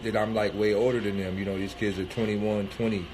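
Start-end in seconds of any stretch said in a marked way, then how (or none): none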